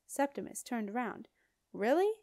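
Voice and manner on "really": loud, rising surprised question; shouted. A narrator's reading. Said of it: "he shouted".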